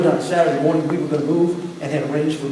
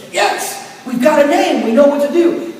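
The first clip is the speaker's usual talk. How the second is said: excited; 6 semitones higher than usual. Retold or imitated. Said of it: "I got really excited".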